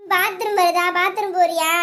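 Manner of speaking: usual speaking voice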